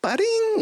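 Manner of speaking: high sung ba-ding; high video game chime; power-up chime. Retold or imitated. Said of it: "he goes".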